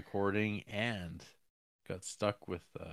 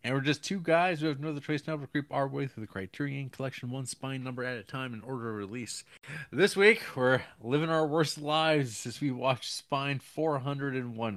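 rushed, loud podcast intro; speaking too fast; 5 semitones higher, 5 dB louder than usual. Real, not acted. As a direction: rattle through the show intro at speed. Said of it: "Why am I speaking so fast?".

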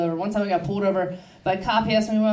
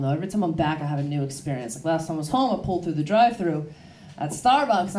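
ordinary talk

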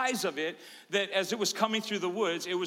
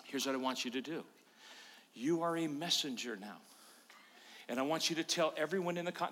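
lower, softer and quick divine instruction; lightly voiced angel, lower and hushed; 5 semitones lower, 8 dB quieter, faster than usual. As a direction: hand down the commission quietly. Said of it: "the angel said to me".

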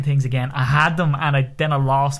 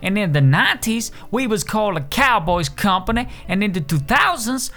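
higher-pitched folksy company boss; Pete; 8 semitones higher than usual. Acted. Company CEO selling moving services, folksy ad voice. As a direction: pitch your business like a homespun local owner.

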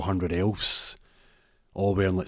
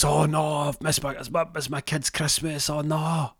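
wailing dismay; 8 semitones higher than usual.

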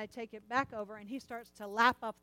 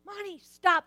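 loud, high scolding of the dog; yelling at the barking dog; sharp shout; 8 semitones higher, 11 dB louder, slower than usual. Retold or imitated. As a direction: snap at the dog to stop. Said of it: "And I'm like".

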